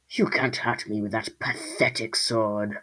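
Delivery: lightly voiced scornful creature; taunting creature. A narrator's reading. Creature circles the squall before fighting, taunting.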